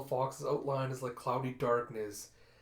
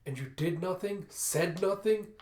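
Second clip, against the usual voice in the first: higher, rising in force on the repeat; indignant accusation; 5 semitones higher, 4 dB louder than usual. A narrator's reading. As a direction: confront him in outrage. Mother confronts drunken father.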